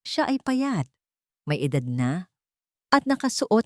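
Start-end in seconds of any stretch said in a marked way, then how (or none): none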